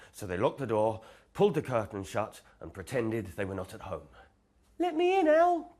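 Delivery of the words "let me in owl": very high, loud squeaky call. Acted. Mouse freezing outside calling Owl, very high and loud. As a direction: squeak it loud through the door. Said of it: "called Mouse".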